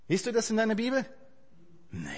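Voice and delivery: usual speaking voice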